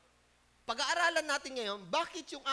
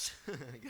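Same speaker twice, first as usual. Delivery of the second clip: laugh; 13 semitones lower, 9 dB quieter than usual. Real, not acted. Preacher laughs at own joke.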